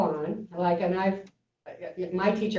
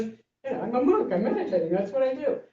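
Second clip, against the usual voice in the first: monk explaining; 6 semitones higher than usual. Retold or imitated.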